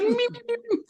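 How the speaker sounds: soft, very high sleeping squeaks; mock cartoon snore. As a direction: imitate comic dozing with repeated tiny peeps. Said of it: "And goes to sleep".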